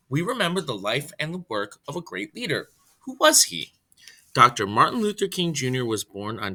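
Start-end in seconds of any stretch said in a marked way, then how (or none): none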